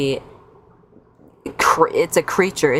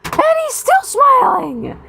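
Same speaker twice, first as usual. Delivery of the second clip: incredulous squeal; 3 dB louder than usual. Real, not acted. Outraged at smiling kid.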